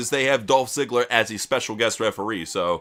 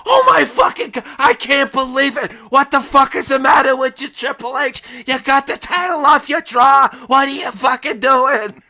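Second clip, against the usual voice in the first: high, loud screaming tantrum; mock rage; 10 semitones higher, 5 dB louder than usual. LaGreca screams at Triple H over the lost title, in a high tantrum. LaGreca's imagined raging reaction.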